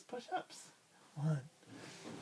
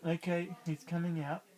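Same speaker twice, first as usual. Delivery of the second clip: ordinary delivery, nothing marked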